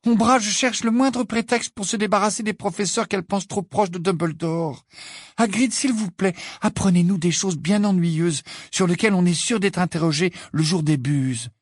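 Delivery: put-on high, pleading girl's voice; touch of despair. A narrator's reading. Pupil begs Hagrid to teach boring topics, mild despair. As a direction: implore him with mild desperation. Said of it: "avec une nuance de désespoir dans la voix".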